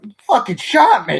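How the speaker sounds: high, loud, indignant shout; outraged high yell. Acted. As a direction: yell it at the man who shot him. Outraged at being shot.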